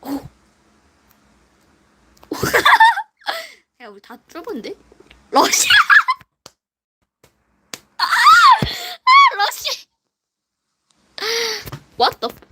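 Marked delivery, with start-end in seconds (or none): 2.3-3.0 squealing laugh; high burst of laughter. Real, not acted. Laughing in game. 8.1-9.4 high shrieking laugh. Real, not acted. Laughing shout.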